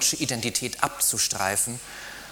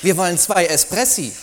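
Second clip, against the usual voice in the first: flustered; 10 semitones higher, 9 dB louder than usual. Acted. He hastily corrects waiter.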